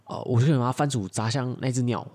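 normal talking voice

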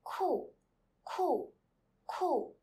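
each "Cool" as Chinese "ku", loud and high, falling fast; falling Mandarin tone. Shown as a demonstration. Teacher drilling students to repeat, falling tone on "Cool". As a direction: say "Cool" like "koo", separately, with steep drops. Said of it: "Repeat after me for two times".

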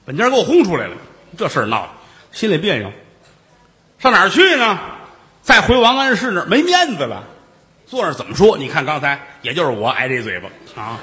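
usual speaking voice

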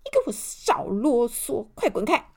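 loud, rude roar; angry roar. A narrator's reading. Mr. Arrogant chases off the little elf, roaring. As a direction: bellow it as the arrogant man. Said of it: "大吼一声".

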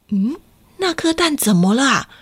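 usual speaking voice

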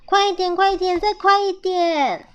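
high, insistent chant; eager urging. A narrator's reading. Child urges faster.

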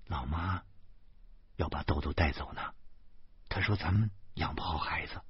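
low, soft delivery; gently breaking news. A narrator's reading. Wife telling husband his mother will take Doudou, hushed. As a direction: tell it quietly, carefully.

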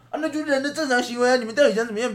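high, loud mock protest; mimicking caught classmate. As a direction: imitate a friend defiantly defending himself, pitch jumping up. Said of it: "他会说". (模仿同学被抓后高声辩解)

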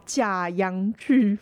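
ordinary talk

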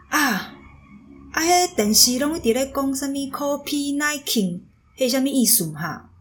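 high, carrying call in Taiwanese, like mom across the hall; imitating mom calling out. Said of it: "很大声的跟我呐喊说". (模仿妈妈大声喊话)

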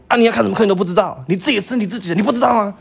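high, rapid-fire scolding, imitated; fierce sergeant. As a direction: act out a sergeant berating a recruit. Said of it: "他就很凶说". (模仿士官长凶巴巴地骂人)

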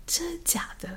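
high, hushed mock whisper; whispered disbelief. Hearing from brother the eagle arrived, whispered disbelief. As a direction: breathe it out in a secretive whisper.

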